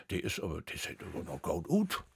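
softly to himself, as a private thought; quiet inner thought. Retold or imitated. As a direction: murmur it like a secret admiration. Retold thought about his future wife. (leise, wie ein heimlicher Gedanke)

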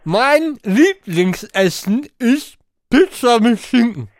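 talking round a mouthful of marshmallows; mouth full. Real, not acted. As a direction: cheeks stuffed, words mushy. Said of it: "ich lese dir mit vollem Mund".

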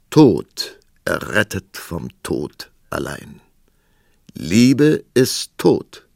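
slow, grave, mysterious recitation; solemn inscription reading. Acted. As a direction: intone it like an ancient prophecy.

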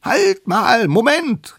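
high, loud squeaky interruption; shrieked character call to stop. Acted. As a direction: shout a halt in a little one's voice. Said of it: "rief da die allerkleinste Mammutkeule".

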